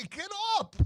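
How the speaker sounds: very high, exasperated mock cry; going off on it. Commentator to fallen player, high mock exasperation. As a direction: exclaim it high, imitating a fed-up commentator. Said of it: "he went off on it".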